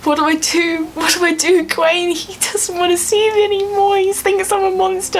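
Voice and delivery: high, distraught pleading; acted crying. Acting Merlin in despair to Gwaine, mock tearful. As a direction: plead tearfully, pitched well up. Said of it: "and Merlin's crying".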